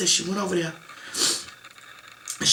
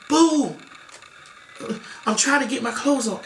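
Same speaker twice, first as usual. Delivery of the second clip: frantic; 6 semitones higher than usual. Retold or imitated. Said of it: "my mom frantic".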